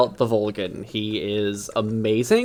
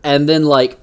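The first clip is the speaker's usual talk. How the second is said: usual speaking voice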